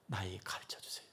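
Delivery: breathy hushed plea; soft pleading whisper. Acted. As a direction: whisper it like a secret prayer. Tender plea to God.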